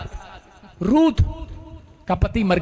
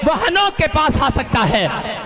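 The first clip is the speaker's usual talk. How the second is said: fervent preaching; 4 semitones higher than usual. Real, not acted. Preaching at full force.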